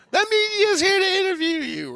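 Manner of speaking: put-on sudden very high squeaky kid voice; kids' voice. Kids announcing the media to him, high and excited. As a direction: pipe it up like excited little kids. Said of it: "the kids go".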